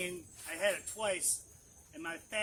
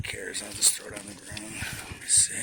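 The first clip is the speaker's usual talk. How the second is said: whispering; 9 semitones lower than usual. Real, not acted. Muttering to himself while unpacking.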